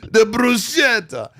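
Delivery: put-on bruschetta in a hammy Italian accent; mock Italian. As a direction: savour the word like a hammed-up Italian. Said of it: "as I said it like an Italian".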